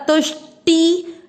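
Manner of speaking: तुष्टि with the ष joined to तु; slow demo word. Shown as a demonstration. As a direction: teach तुष्टि syllable by syllable. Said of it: "ट पे ई के मात्रा".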